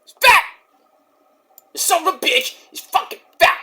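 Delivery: high angry shouted insults; Scott Steiner rant. Retold or imitated. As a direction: rant at the crowd like a mad wrestler. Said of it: "You tell him, Scott".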